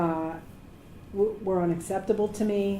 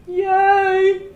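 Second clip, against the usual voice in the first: high, loud mock cheer; 10 semitones higher, 8 dB louder than usual. Assistant cheering a booking favour, playful yay.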